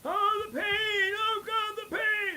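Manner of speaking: very high, loud wail of pain; a patient's high, loud cries of pain; pained hollering. Retold or imitated. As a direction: howl it like an old man in agony. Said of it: "I can actually hear the guy from outside hollering".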